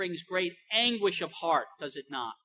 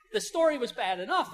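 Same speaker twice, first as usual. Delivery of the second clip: normal talking voice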